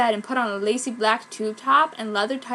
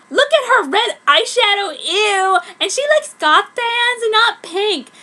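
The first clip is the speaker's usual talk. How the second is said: high-pitched preppy voice; 9 semitones higher, 9 dB louder than usual. Acted. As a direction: sneer it like a shrill valley girl. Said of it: "in a high-pitched preppy voice".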